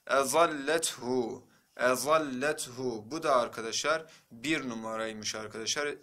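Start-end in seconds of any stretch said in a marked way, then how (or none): 0.1-3.1 "Ezallethu" slow, with held doubled L; model reading. Shown as a demonstration. Teacher reads the next word for students, slow model reading. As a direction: stretch "Ezallethu" syllable by syllable. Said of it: "Sıradakine bakalım arkadaşlar".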